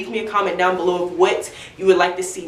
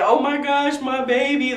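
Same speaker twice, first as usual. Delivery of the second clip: a higher, moved "oh my gosh, my baby" as retold; emotional; 6 semitones higher than usual. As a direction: relive the tearful first sight of the baby. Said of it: "it was so emotional".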